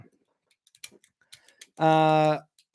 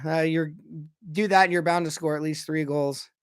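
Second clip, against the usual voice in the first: ordinary talk, nothing marked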